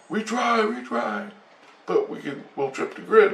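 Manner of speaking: high and helpless like a small alien. Retold or imitated. Alien explains limits.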